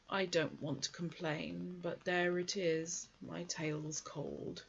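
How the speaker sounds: mournful donkey martyrdom; gloomy Eeyore. Acted. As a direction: Eeyore moaning about his cold tail. Eeyore's voice held.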